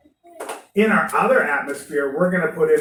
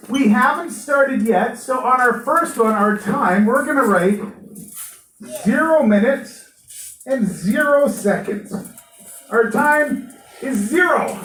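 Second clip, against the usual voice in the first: loud; 6 semitones higher, 4 dB louder than usual. Real, not acted. Class announcement, calling out.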